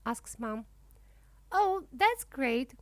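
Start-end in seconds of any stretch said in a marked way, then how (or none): 1.5-2.7 high, louder, slow gasp of delight; delighted cry. A narrator's reading. Dragon loves the name Sunny, delighted cry. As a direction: savour the joy of the new name. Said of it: "cries Dragon".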